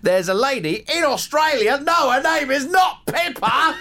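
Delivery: mock Australian accent. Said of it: "You tried to do that Australian accent".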